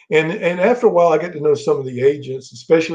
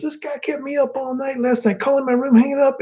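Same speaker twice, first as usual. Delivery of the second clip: high, strained mock yell; hammed-up furious roar at the culprit; imitating Sid yelling at Bobby; 10 semitones higher than usual. Sid complaining, retold.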